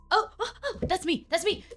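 high, loud, excited outburst; over-eager pupil, quick repeated cries; eager student. Acted. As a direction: jump in like a kid whose name was called. Plays eager student hearing name called.

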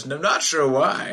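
normal talking voice

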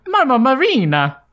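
high Italian accent, hammed up; lightly mock an Italian mama. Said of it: "your best Italian accent".

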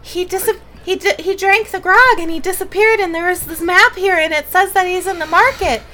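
high, loud, breathless rush; winded Radcliffe. Acted. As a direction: blurt it out, out of breath and excited. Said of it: "very windedly".

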